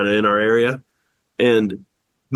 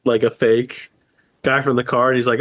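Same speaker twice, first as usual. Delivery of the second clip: ordinary talk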